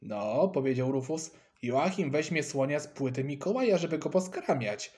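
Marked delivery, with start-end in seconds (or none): none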